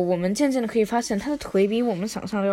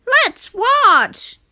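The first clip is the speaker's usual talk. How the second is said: put-on high, loud eager kid; 13 semitones higher, 6 dB louder than usual. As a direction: squeal like an excited kid. Kid voice in story.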